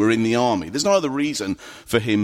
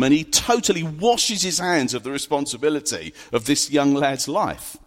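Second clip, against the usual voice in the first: ordinary delivery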